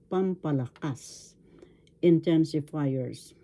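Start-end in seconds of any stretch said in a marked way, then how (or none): 0.1-1.4 pampalakas with the final KAS stressed; teacher modelling pampalakas, accent on KAS; stress on fourth syllable. Shown as a demonstration. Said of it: "The accent is in the fourth syllable".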